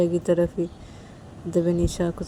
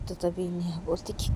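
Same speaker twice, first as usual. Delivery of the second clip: usual speaking voice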